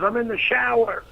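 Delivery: high-pitched answering call; retold call from the shower; calling back. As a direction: call back from another room, imitated.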